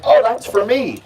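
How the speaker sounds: a perky touch of recognition; mildly excited. Acted. Alligator hearing her special call, mildly excited.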